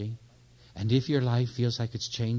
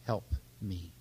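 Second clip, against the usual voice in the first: humble prayer; 5 semitones lower, 9 dB quieter, much slower than usual. Shown as a demonstration. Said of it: "here's where you come and say".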